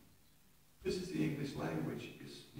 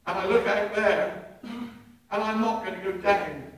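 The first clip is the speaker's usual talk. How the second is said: loud, higher, exultant preaching; fervent preaching; 5 semitones higher, 12 dB louder than usual. Real, not acted. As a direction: preach it with full fire. Preaching at full force.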